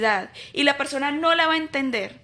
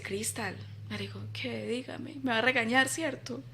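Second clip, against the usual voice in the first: hushed; 10 dB quieter than usual. Retold or imitated. Friend speaks softly in quotes.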